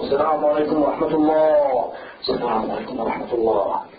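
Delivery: low, aloof droning greeting; mock holy man. Maulana who wants to be seen as holy, unsmiling mock-pious greeting. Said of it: "He does not smile at all".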